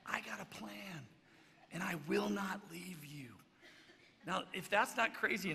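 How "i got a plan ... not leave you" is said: slow, secretive promise; hushed scheming Peter; confiding Peter. Retold or imitated. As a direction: reassure him slowly.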